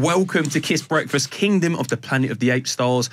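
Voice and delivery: ordinary talk, nothing marked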